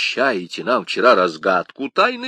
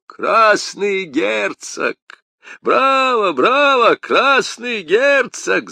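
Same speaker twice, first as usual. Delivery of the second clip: much higher and louder, slow cheering bravo; shouting with delight; 12 semitones higher, 6 dB louder, much slower than usual. A narrator's reading. Porthos loudly applauding Aramis's witty nickname. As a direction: clap and cheer the joke. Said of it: "закричал Портос".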